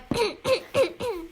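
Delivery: short high throat-clearing coughs; imitated choking. Student acting out choking on water in class, mock choking.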